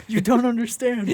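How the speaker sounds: a mild mock yell from a superfan; yelling fan. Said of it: "he'd be yelling".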